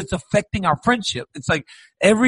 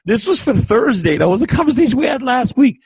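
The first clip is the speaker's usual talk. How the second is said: indignant protest; 6 semitones higher than usual. Retold or imitated. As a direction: protest it, climbing higher. Acted indignant protest to friend.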